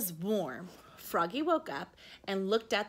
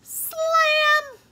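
high, loud, punchy slam; sound effect; 13 dB louder than usual. A narrator's reading. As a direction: slam the word out with force. Sound effect of door slamming.

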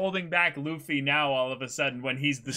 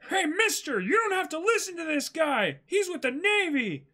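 much higher, eager warning; urgent Luffy; 10 semitones higher than usual. Acted. Luffy warns the villager against the Navy man. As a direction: call over to the stranger.

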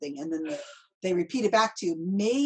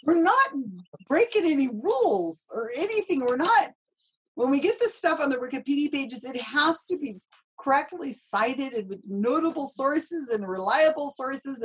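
real excitement, pitched up; excited; 5 semitones higher, 3 dB louder than usual. Real, not acted. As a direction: gush with enthusiasm. Gushing about Wikipedia editing.

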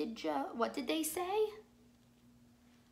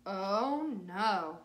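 usual speaking voice

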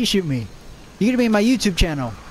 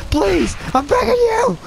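mock pleading; 10 semitones higher, slower than usual. Gamer begging an enemy dinosaur, mock terrified pleading. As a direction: beg for your life, over the top.

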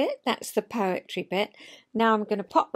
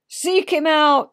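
a loud command to the dogs; barked order; 5 semitones higher, 9 dB louder, slower than usual. Acted. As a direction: send the hounds off hard. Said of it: "the order came".

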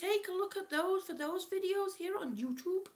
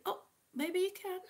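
usual speaking voice

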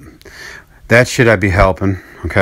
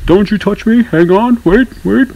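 much higher, pleading voice; imagined fallen old man; 11 semitones higher than usual. Retold or imitated. Imagining the neighbor refusing paramedics, high pleading. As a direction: whine it like a helpless old man. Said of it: "and I imagine him saying".

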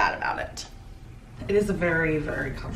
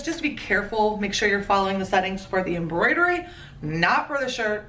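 ordinary delivery

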